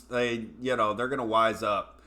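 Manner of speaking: normal talking voice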